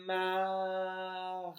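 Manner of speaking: Mar held long with the aaa drawn out; stretched syllable. Shown as a demonstration. Teacher letting child hear the vowel, Mar stretched. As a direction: draw out the a in Mar. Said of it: "On entend le son".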